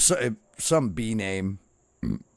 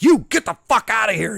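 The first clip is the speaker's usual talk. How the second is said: high, loud dismissive bark; drill instructor yell; 9 dB louder than usual. Retold or imitated. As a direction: throw him out like an angry drill instructor.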